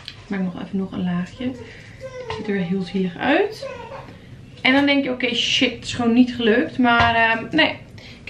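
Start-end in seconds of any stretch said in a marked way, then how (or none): 1.4-3.7 pitying. Real, not acted. Pitying the sad dessert.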